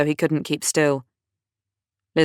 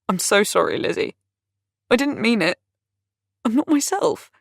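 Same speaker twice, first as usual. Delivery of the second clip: tearful apology; 7 semitones higher than usual. A narrator's reading. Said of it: "tears in his eyes".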